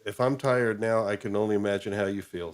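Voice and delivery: ordinary talk, nothing marked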